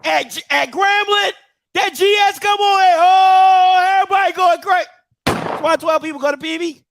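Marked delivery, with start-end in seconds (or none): 1.7-4.9 crowd going crazy. Retold or imitated. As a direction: build to a big held "oh" like a wild crowd. Imitates Grambling's crowd going wild.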